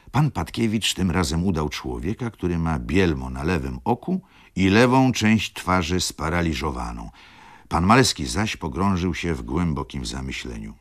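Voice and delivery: plain reading voice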